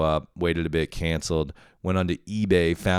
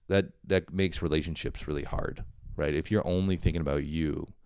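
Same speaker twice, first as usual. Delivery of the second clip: usual speaking voice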